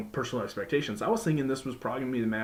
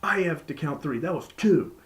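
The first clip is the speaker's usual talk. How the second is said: firm, commanding referee, raised and higher; emphatic referee; 6 semitones higher, 4 dB louder than usual. Retold or imitated. Referee ordering the bell ringer to wait, emphatic and commanding. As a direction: lay down the law like a ref scolding the bell ringer. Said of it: "very emphatic".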